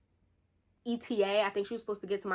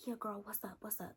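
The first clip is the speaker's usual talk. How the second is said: much softer, near whisper; hushed friend; 14 dB quieter than usual. Retold or imitated. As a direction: keep it hushed so nobody hears. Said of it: "But imagine I'm whispering".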